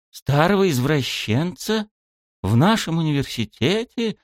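high, rising incredulous questions; surprised. A narrator's reading. Club head half-rising from seat, surprised. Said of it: "Удивлённо аж привстала она с места".

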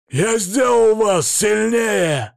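loud, high, drawn-out roar; furious growl. A narrator's reading. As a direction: roar it slowly in self-defence. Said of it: "зарычал он". (яростный рык)

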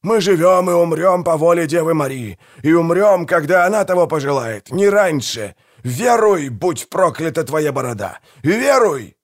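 high, fervent bellowing; impassioned, high-pitched tirade; roaring. A narrator's reading. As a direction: preach it furiously at him. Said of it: "взревел Манфред".

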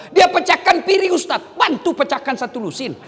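high, loud, mounting exhortation; full-force preaching. Acted. Preacher urging husbands not to be trampled, fiery and loud. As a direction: build to a shouted rallying cry.

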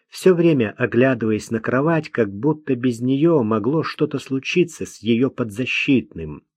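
ordinary delivery, nothing marked

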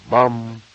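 resonant "bam" of a bell; imitated clock chime. A narrator's reading. The radio striking midnight, chime imitation. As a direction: voice a single clock stroke.

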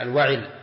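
الوعل said al-wa'il, with "i" after the ayn; second syllable of الوعل voweled "i": wa-'il; kasra on ayn. Shown as a demonstration. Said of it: "وكسرها".

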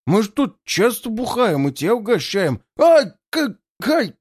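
high pained yelps; panicked yelling. A narrator's reading. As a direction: yelp as the blows land. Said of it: "орут пьяницы в панике".

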